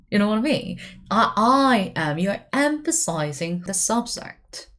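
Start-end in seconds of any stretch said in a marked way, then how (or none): none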